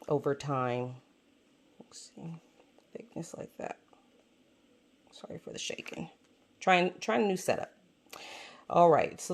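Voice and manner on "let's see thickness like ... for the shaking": hushed aside under the breath; whispering. Real, not acted. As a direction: drop to a whisper while fiddling. Quiet aside while adjusting camera.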